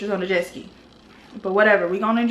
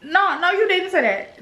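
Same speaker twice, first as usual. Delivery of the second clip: higher, slow disbelieving retort; mock outrage; 8 semitones higher, slower than usual. Snapping back at the baggage clerk, higher and slow.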